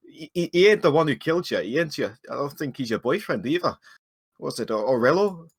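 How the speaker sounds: hesitant dwarf trying his luck; awkward, unsure dwarf. Acted. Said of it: "I don't really know what I'm doing".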